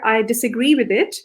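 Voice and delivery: normal talking voice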